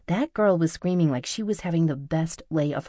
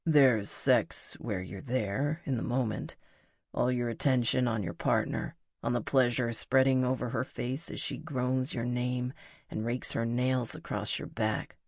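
lower, measured voice for the musician; intimate male lover, earnest; 3 semitones lower, 3 dB quieter than usual. Acted. Musician describes good sex to her, low and intimate. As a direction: bring the pitch down and explain it intimately. Narrator voices Pete explaining.

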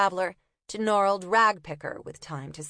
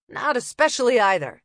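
louder, huffy copper; impatient copper; 5 semitones higher, 7 dB louder than usual. Acted. Winded copper answering Una, huffy. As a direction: huff it out impatiently.

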